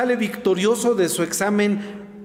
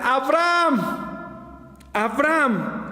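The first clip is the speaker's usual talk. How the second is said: high, drawn-out calling of the name, from far above; heavenly call; 6 semitones higher, much slower than usual. Acted. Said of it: "Le grita". (voz del cielo que llama a Abraham, alta y alargada)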